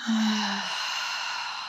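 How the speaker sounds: slow, soft breath out through the mouth; long audible exhale. Shown as a demonstration. Host breathing out with her listeners, long soft sigh. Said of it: "Take a deep breath in".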